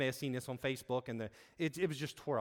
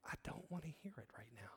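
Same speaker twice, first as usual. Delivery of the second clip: very soft, near-whispered admission; retold confession, barely voiced; hushed confession; 15 dB quieter than usual. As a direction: drop to a near whisper, as if confiding. Retold pastor admits weariness of scripture.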